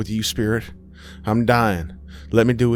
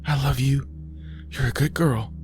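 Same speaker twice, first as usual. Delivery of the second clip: higher-pitched, tender words to a dog; tender farewell; 8 semitones higher than usual. Acted. Aisha says goodbye to her dog, tender. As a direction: say a loving goodbye to a pet.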